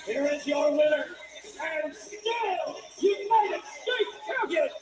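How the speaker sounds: slow, loud, stretched-out announcement; ring announcer. Real, not acted. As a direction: draw out the champion's name. Announces champion, drawn out.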